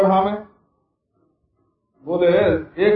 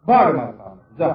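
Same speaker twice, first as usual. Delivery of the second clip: brusque dismissal; 6 semitones lower than usual. Acted. God dismisses devotee in story.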